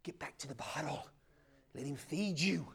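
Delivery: urgent whispered plea. Real, not acted. Preacher urging the church to return, whispering. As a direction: lean in and whisper it.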